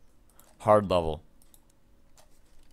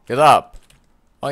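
ordinary talk, nothing marked

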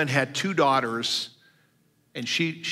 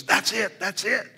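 high, eager "that's it" twice; a burst of mock glee; excited agreement; 7 semitones higher than usual. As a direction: voice the boss lighting up. Director suddenly gets it.

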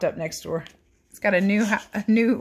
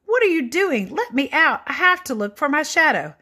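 shouting; 9 semitones higher, 6 dB louder than usual. Acted. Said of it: "he shouted".

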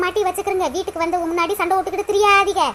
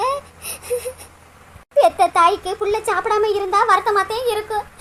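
normal talking voice

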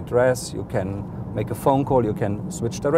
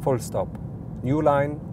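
dictating. Shown as a demonstration. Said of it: "you can freely dictate text".

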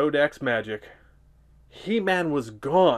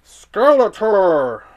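Merman voice; 6 semitones higher, 10 dB louder, slower than usual. Acted. Merman voice in read story.

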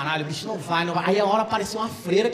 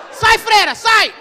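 high, loud terrified yell; panicked mock exorcism shout; shouting at the nun; 11 semitones higher, 7 dB louder, much slower than usual. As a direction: scream the nun out of the house. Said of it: "Gritando alto".

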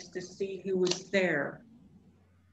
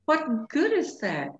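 higher, mildly tearful bunny; sniffing protest; 6 semitones higher, 4 dB louder than usual. A narrator's reading. Sad bunny doubts the wolf's comfort, mildly sniffly. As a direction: ask it with a touch of hurt, pitched up. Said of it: "Barrington sniffed".

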